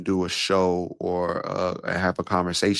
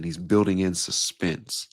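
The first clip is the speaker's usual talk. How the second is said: slower, leaving a long pause after; slowed, with suspense; slower than usual. Shown as a demonstration. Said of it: "Just like I did right there".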